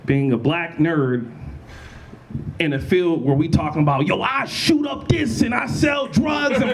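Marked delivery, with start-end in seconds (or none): 4.1-6.7 mimicked rapper boasting. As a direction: put on a hyped-up tough rapper. Mimics typical rappers' tough boasts.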